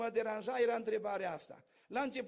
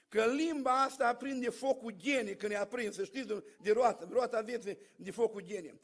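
fiery warning; 6 dB louder than usual. Acted. Warning about the tongue.

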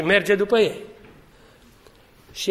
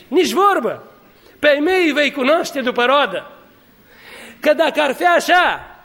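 full-force preaching; 5 semitones higher than usual. Acted. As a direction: thunder it from the pulpit.

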